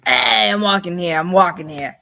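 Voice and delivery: loud, low New York street holler; hammed-up New York accent. Shown as a demonstration. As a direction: call out like an indignant New Yorker. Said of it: "I have a New York accent".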